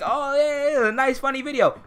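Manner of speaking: high-pitched, dismissive imitation of a fan; mock approving commenter. Debater mimicking commenters who like the video, mock approving. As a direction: voice a pleased viewer, pitched well up.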